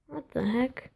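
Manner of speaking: soft puzzled aside; quiet confused. Retold or imitated. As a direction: say it softly, baffled. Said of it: "I'm sitting here thinking".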